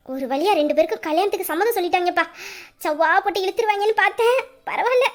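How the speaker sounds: ordinary talk, nothing marked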